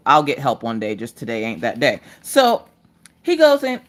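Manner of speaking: ordinary delivery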